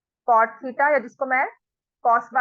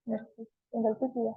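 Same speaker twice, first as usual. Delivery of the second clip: low, soft self-talk while working; talking to oneself; 3 semitones lower, 10 dB quieter, much slower than usual. Real, not acted. Teacher checking own working for a mistake, quiet self-talk. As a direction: think aloud quietly, almost to yourself.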